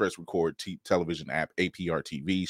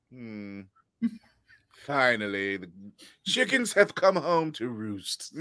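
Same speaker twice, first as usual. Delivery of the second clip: lightly British, slow and smug; a touch of a posh British father, savoring it; hint of a British accent, slow; mock British dad; slower than usual. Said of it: "my father does not talk like that".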